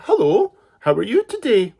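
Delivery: put-on high, loud, bright little-girl greeting; polite little girl. Girl greets the wolf politely, high and cheery. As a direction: chirp it like a very polite child.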